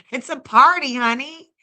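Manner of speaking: loud hype party call. Real, not acted. Streamer hyping chat that it's a party, loud call. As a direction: belt it like a hostess.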